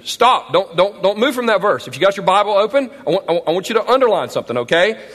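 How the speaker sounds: ordinary delivery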